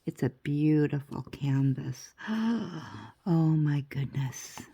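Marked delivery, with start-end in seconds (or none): none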